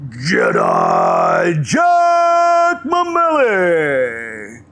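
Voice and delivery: booming slow drawn-out announcer shout; monster truck voice. Pretend. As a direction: roar the name like a monster truck ad. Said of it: "you have to do the monster truck voice".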